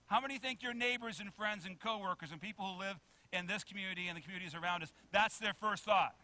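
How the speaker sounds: normal talking voice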